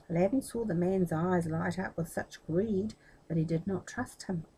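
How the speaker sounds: ordinary delivery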